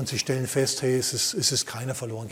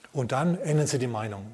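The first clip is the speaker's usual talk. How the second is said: normal talking voice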